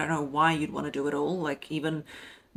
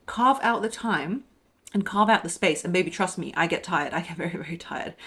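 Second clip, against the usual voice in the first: ordinary delivery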